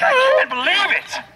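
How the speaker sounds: high-pitched, upset outburst; hammed-up frustrated disbelief; passionate frustration. Shown as a demonstration. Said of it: "this passion in her voice".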